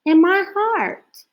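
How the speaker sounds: high, hopeful small voice; lonely owl offering its stories; little owl voice. A narrator's reading.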